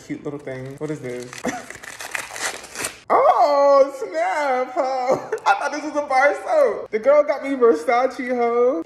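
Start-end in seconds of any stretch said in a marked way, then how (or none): 3.1-8.8 high, loud delighted squeal of surprise; thrilled by an unexpected gift; excited surprise. Real, not acted. Unwrapping surprise Versace gift.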